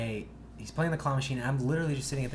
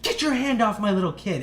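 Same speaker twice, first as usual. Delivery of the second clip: high, loud protective outburst; retold angry mom; 9 semitones higher, 7 dB louder than usual. Mom confronts teen who grabbed her kid, angry imitation. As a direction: play an outraged mother ordering someone off. Angry mother grabs teller's wrist.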